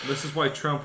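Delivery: ordinary talk, nothing marked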